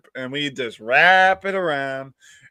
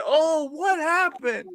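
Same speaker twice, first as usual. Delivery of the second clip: high, drawn-out mimicry of onlookers; put-on high questioning voice; mimicked onlookers; 12 semitones higher, slower than usual. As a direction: imitate people asking, pitched up and slow. Mimics people asking about the damage.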